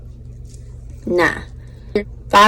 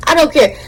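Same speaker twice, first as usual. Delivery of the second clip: higher, louder I don't care with don't hit hard; emphatic repeat; 6 semitones higher, 11 dB louder than usual. Real, not acted. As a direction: punch the word don't on the repeat. Repeated after laughing, emphatic.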